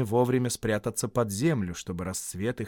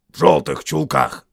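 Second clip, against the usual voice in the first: lightly voiced troll, louder; Берт; 4 semitones higher, 10 dB louder than usual. A narrator's reading. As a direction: answer loudly as a troll. Troll Bert answers.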